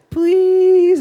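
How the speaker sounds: put-on high, loud, drawn-out child's plea; little girl begging. Daughter begs dad about the lipstick, high mock pleading. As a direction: imitate a small daughter begging hard.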